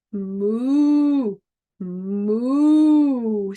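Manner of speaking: long drawn-out moos; cow mooing. Acted. Cow calls to the spider, long mooing. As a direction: moo it like a storybook cow. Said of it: "said the cow".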